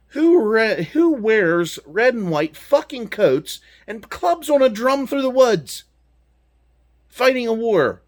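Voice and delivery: loud, high, slowed-down outraged rant; indignant rant. Real, not acted. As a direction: bellow it out in disbelief at their stupidity. Ranting about redcoat tactics.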